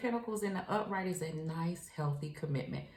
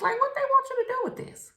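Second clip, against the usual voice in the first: exasperated; 8 dB louder, faster than usual. Real, not acted. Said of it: "I'm getting frustrated".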